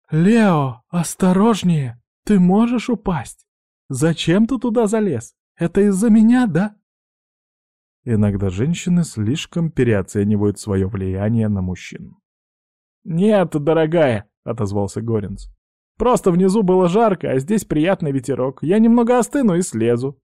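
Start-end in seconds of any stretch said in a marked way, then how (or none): 0.1-6.8 high-pitched, anxious calling out; alarmed cry. Acted. Sweetheart calling to her man high on the mast, alarmed cry. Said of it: "она закричала". 13.0-14.3 reassuring. Acted. Reassuring sweetheart from atop mast. 16.0-20.2 making excuses. Acted. High up, making excuses to sweetheart.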